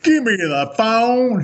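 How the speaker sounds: mock Texan demanding the phone; Texan drawl. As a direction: do the friend's Texas drawl, high and slow. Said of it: "he talks like a Texan".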